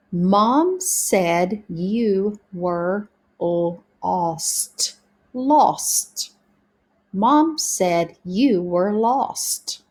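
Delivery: very slow, word-by-word model reading; practicing together. Shown as a demonstration. Teacher practicing sentences together with pupils, slow sounded-out reading. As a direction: read slowly for beginners, sounding out "lost". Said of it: "let's practice together now".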